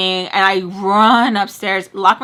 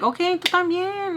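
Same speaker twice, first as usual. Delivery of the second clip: higher-pitched sing-song "sure, you too"; high mock reply; 9 semitones higher, 4 dB quieter than usual. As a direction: toss it back high and mocking. Sarcastic retold reply in high pitch.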